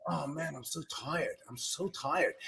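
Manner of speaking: soft, tired self-pity; weary moaning. Retold or imitated. At the gym, moaning to himself about being tired. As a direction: groan it out as if worn out. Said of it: "I kept saying to myself".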